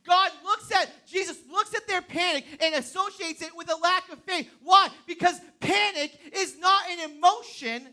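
loud, rising preacher's call; full-force preaching. Acted. Pastor rallying the congregation, loud and driving. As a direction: preach it out to the room.

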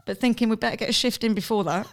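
ordinary talk, nothing marked

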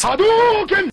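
high, slow special-move shout; grito de golpe. Pretend. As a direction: yell it like a fighting game attack. Grita o nome como golpe. (grito de golpe de luta)

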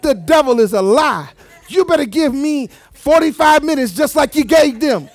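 loud preacher's outburst; emphatic, indignant preaching; indignant demand. Acted.

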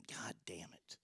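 whispered curse; whispering. Real, not acted. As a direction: curse under your breath in a whisper. Whispered curse while on hold.

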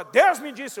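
imitação forte e aguda do profeta; proclamação profética. Retold or imitated. (imitando o profeta em voz alta)

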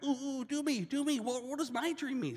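high, eager begging; eager prisoner. Retold or imitated. The second prisoner begs Joseph to read his dream, high and eager. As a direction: bounce in like a kid wanting a turn. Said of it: "The other guy's like".

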